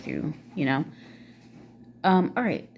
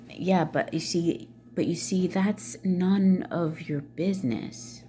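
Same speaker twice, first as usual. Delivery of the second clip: slow, measured, gentle brush-off; kind, gray-rock; slower than usual. Retold or imitated. Daughter closing the door on her narcissist mother, kindly gray-rocking. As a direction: say it kindly and evenly, drawing the words out. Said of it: "kindly or gray rockingly say".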